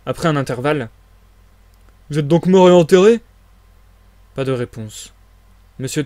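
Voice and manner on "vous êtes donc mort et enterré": loud, higher taunting call; impatient calling out. Acted. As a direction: shout to get an answer from someone hiding. Marvel calls to silent hidden voice.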